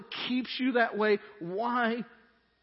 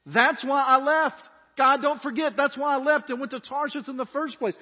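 much louder, higher, slowed and pressed; emphatic outburst; 7 semitones higher, 7 dB louder than usual. Retold or imitated. Jonah venting loudly at God, slowed and emphatic. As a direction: hammer home the grievance. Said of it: "He goes on to say this".

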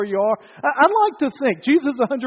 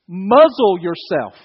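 stern rebuke; 8 dB louder, slower than usual. Acted. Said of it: "That's what he says to the storm".

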